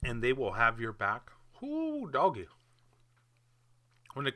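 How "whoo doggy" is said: a high yelping whoo, much higher; excited whoop. Real, not acted. Vlogger whooping over his Russia praise, excited. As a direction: let it burst out like a cheer.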